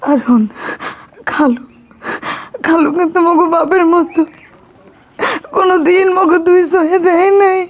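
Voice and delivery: tearful, halting plea; choked up with crying. Acted. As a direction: plead through sobs, pausing between phrases. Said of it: "আপনি কান্দেন না".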